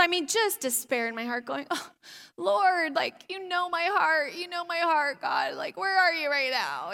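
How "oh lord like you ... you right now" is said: pitiful prayer. Retold or imitated. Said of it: "just all pitiful".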